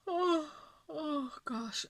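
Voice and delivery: slow words stretched by a real yawn; tired yawn dragging the words out. Real, not acted. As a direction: yawn right through it. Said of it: "I'm just yawning".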